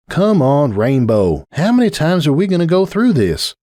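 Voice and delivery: a tired sigh of a friend, lightly voiced; Applejack. Applejack pleading with Rainbow, lightly voiced patient mare.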